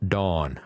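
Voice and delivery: Dawn said exactly like the name Don, short open o; Don pronunciation. Shown as a demonstration. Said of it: "That's a D O N".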